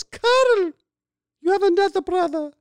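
put-on high, loud Hungarian-accented woman's voice; Hungarian mother. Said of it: "saying it like a Hungarian lady".

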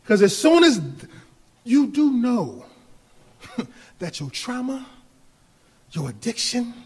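ordinary delivery

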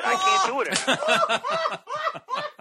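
very high, drawn-out strained wail; hammed-up exhausted plea; comic desperation. Real, not acted. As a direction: wail it slowly like you're giving up. Comic plea of being worn out.

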